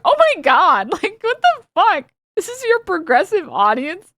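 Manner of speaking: high, slow, laughing in disbelief. Real, not acted. Streamer reacting to hateful messages, laughing in disbelief. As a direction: laugh it out, can't believe it.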